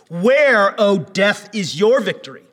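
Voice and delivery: loud, high proclaiming; fervent preaching. Acted. Preacher proclaiming Paul's words to death, full force. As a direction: proclaim it to the whole room.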